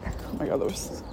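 hushed, sheepish aside; embarrassed whisper. Real, not acted. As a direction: whisper it, caught off guard. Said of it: "So awkward. Someone just walked by".

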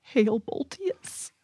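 slow reverent whisper; soft, slow, solemn hail; whispered salute. Acted. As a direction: breathe the salute like a prayer.

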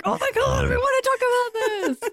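high-pitched mock freak-out. Host recalls both of them panicking over imposter syndrome, mock freak-out. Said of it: "we were both freaking out in a way".

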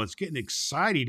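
ordinary delivery, nothing marked

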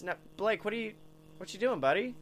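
normal talking voice

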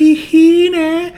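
high, loud drawn-out mock holler; imitated yell. Host imitating Eric's call over the fence, high yell. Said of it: "like, yells for him".

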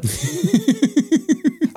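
high-pitched burst of laughter. Real, not acted. Host laughs at his own story, high giggle. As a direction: cackle up high with delight.

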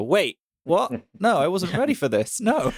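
high, rising mock surprise; caught off guard. Viewer caught off guard by a sad scene, surprised. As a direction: play the viewer blindsided. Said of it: "and you kinda go".

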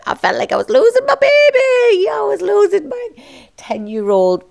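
high, cracking voice, close to tears; real tears breaking through. Real, not acted. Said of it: "it's making me emotional now saying this to you".

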